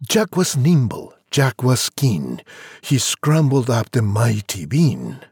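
ordinary delivery

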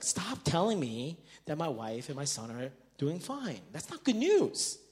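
usual speaking voice

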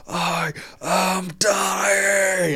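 high, very drawn-out strained shout; mock gym shout. Lifter retelling his shout mid-deadlift, high drawn-out yell. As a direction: stretch each word into a long, high cry. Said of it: "So you just shouted across the whole gym".

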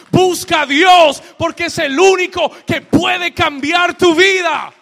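loud, slowing preacher shout building to a cry; preaching at full force. Acted. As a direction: drive it home like a revival preacher at the top of the lungs.

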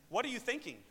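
high-pitched exasperated question; mock exasperation. Broke seminary student questions pregnant wife, mock exasperation. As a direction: scold in comic disbelief. Retold husband's panic at pregnancy.

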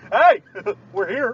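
playful hail to friends; calling out. Pretend. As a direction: shout it like an arrival. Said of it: "calling out again".

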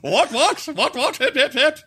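very high-pitched British caricature; mock British toff. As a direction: chirp it like a silly British aristocrat. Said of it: "He turned real British".

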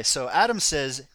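ordinary talk, nothing marked

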